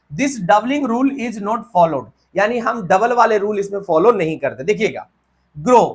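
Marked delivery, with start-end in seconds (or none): 0.1-2.1 reading the rule out slowly, word by word; teacher dictating a rule. Shown as a demonstration.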